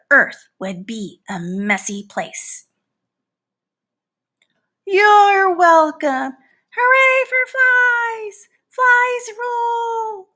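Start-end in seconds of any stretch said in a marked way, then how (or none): 4.8-10.3 triumphant cheer. Acted. Fly cheering for flies at the end, high slow hooray. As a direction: cheer like a crowd at a show.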